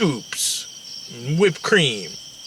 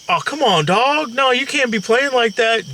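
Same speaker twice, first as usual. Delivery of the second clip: mock preachy friend; 8 semitones higher than usual.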